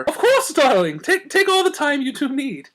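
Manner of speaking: high, grand gracious lady voice. A narrator's reading. Rarity covering her laugh for friends, gracious and high. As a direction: play the gracious hostess, high and lilting. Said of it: "Rarity graciously allowed".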